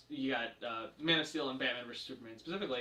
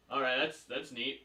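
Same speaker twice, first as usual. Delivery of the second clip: understated, underwhelmed imitation; deflating; 3 semitones lower than usual. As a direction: let the grandeur drop with a low-key reply. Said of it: "he just, like, deflates it".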